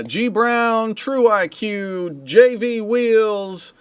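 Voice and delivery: high, slow roll call; names sung out high and long; drawn-out shout-outs. Real, not acted. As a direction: stretch each name out like an announcer. Calling out names from the chat.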